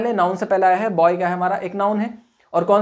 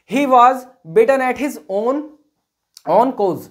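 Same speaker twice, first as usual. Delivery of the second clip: slow dictation; 3 semitones higher, much slower than usual. Shown as a demonstration. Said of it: "dictating example slowly".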